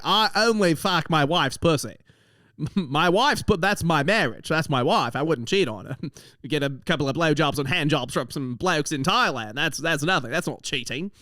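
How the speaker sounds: hammed-up Aussie bloke justifying himself; imitating an indignant Australian mate; Australian accent. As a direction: protest in a broad, amateur Australian accent. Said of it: "I'm trying to do an Australian accent".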